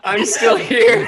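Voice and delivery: slow, laughing words; cracking up while speaking. Real, not acted. Laughing, echoing his own line.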